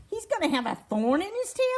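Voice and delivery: alarmed question. A narrator's reading. Fuzz fears for the turtle's tail, alarmed. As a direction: voice a storybook animal's mild alarm.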